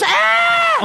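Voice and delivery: very high loud shriek; panicked high scream. Acted. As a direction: scream like a frightened woman in the corner. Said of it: "the lady over in the corner says".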